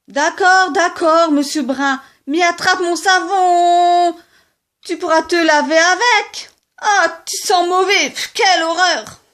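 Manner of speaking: high, loud taunting; mocking retort. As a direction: throw the soap with scorn. Rabbits quarrel. (riposte moqueuse, aiguë et forte)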